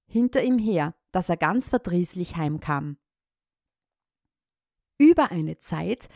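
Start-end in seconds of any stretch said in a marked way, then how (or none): none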